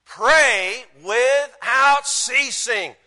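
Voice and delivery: very slow, loud, high preaching; emphatic proclamation. Real, not acted. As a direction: stretch each word out as a command. Preacher proclaims verse as upgrade.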